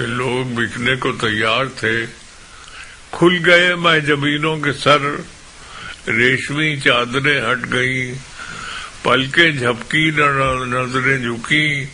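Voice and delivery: emphatic, declaimed Urdu verse; impassioned poetry recital; poem recitation. Acted. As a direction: ring out each line to a mushaira audience.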